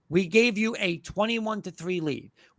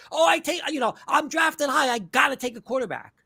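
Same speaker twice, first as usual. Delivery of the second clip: much higher, hammed-up shout; mock desperate GM; 5 semitones higher than usual. Host mocking teams that take a quarterback, high mock shout. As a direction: squeal like a panicked team picking high.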